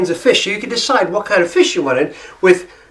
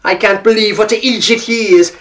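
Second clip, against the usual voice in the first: lightly imitated Irish accent, a bit higher; Irish coworker; 4 semitones higher than usual. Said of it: "woman I'm working with".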